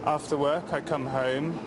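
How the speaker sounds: normal talking voice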